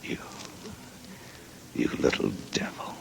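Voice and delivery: hushed tender whisper; tender whisper before a kiss. Acted. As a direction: breathe it to her softly.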